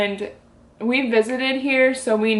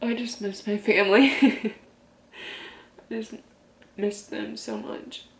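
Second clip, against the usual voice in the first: barely holding it together, slowing down; on the verge of tears. Real, not acted. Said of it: "I'm trying not to get emotional".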